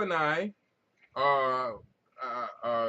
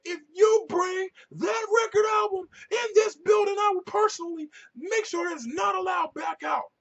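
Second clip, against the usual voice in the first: much higher, louder hammed-up yell; imitated shouting threat; 7 dB louder than usual. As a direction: threaten him like an outraged librarian. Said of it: "that's when she's like".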